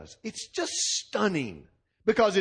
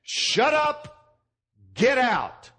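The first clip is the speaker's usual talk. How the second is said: a sharp, higher command to leave; commanding rebuke; 7 semitones higher, much slower than usual. Retold or imitated. Jesus rebuking a demon, sharp higher command. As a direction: order the demon out with authority. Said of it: "He simply says".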